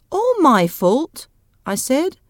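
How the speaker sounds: high, loud, drawn-out question; slow, affronted query; indignant disbelief. Acted. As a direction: throw the blame back in disbelief. Husband protests at being blamed.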